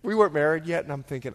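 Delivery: normal talking voice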